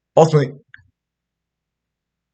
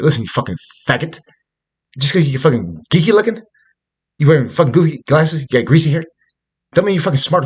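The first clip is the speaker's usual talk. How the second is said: retold angry rant; 6 semitones higher, 7 dB louder than usual. Retold insult at the TV social worker.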